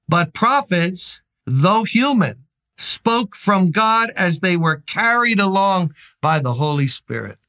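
solemn Bible reading; scripture reading. Acted. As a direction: read the verse with weight. Reads scripture.

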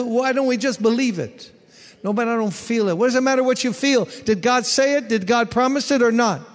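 high, loud, fast fiery preaching; shouted sermon climax, fast and driving; preaching at full force. Acted. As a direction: hammer it home like a revival preacher.

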